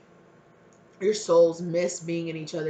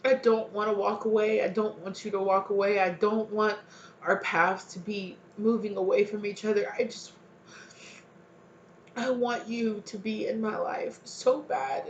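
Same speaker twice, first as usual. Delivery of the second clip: begging; 4 semitones higher than usual. Acted. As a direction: beg them not to walk away. Voicing someone begging them not to leave.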